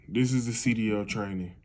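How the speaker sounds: usual speaking voice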